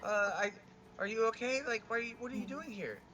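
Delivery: high, loud, stammering affection; gushing reunion. Acted. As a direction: tumble over your words greeting a dear friend. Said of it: "I love you".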